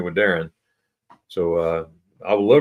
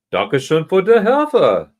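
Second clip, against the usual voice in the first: put-on German thanks; 10 semitones higher, 7 dB louder than usual.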